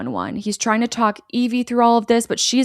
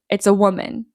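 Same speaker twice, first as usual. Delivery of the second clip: normal talking voice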